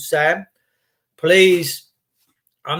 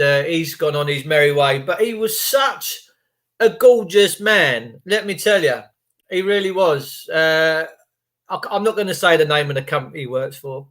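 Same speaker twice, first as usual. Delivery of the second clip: ordinary talk, nothing marked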